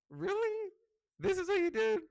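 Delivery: very high, loud incredulous squeal; mock disbelief. Birthday girl teasing the friend who surprised her, mock disbelief. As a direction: playfully protest with pitch shooting up.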